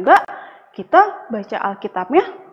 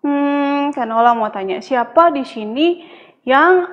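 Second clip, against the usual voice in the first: put-on reading to a toddler. Asks children about picture.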